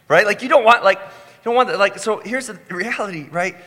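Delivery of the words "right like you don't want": ordinary delivery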